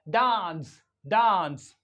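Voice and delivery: डांस as 'dahns', long open vowel; British long A. Shown as a demonstration. Teacher answering her own question, long-ah डांस. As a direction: say डांस with the A of father. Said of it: "ए का pronunciation क्या होगा".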